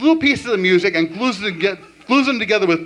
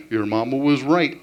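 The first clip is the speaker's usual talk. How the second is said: cartoon Yogi voice; 5 semitones lower than usual. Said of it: "talk to each other in cartoon voices".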